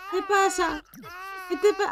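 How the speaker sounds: put-on high coaxing voice to a crying baby; soothing a crying baby in the game, high baby talk.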